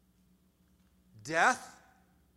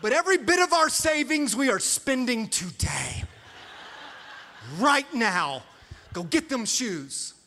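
much higher and louder, giddy and reckless; hammed-up spending frenzy; 6 semitones higher, 9 dB louder than usual. Husband urging his wife to spend all their savings, loud mock mania. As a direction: sell it like a crazed shopaholic. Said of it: "And I'm like".

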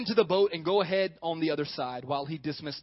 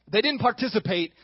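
usual speaking voice

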